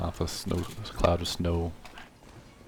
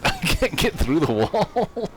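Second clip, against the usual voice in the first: laughing; 8 semitones higher, 3 dB louder, slower than usual. Real, not acted. Stuck behind a wall, laughing.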